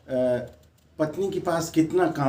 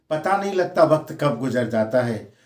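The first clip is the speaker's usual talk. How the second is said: usual speaking voice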